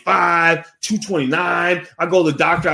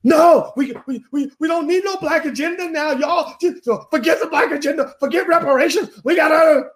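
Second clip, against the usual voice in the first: much higher mocking voice, rising to a shout at the end; high sneering caricature of a pundit; Amanda Seales impression; 12 semitones higher than usual. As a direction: lampoon her in a high, strained, exaggerated pitch. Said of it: "That's where Amanda Seales comes in".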